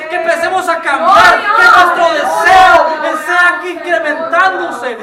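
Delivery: builds to loud, ringing preaching, pushing the church to wake up; fiery preaching. Acted. Preacher urging the church not to be lukewarm, fiery preaching. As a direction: preach it with rising fire, voice swelling on each appeal.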